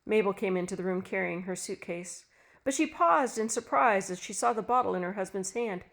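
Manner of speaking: ordinary delivery, nothing marked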